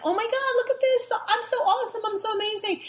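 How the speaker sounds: hammed-up high voice of a smitten man; mock vain gush. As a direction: preen in a high mocking falsetto. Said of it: "And he was like".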